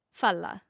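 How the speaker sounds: Fala said with no H and the V softened to an F; local pronunciation. Shown as a demonstration. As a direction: say Fala with a silent H and an F sound. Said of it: "but you don't say the H".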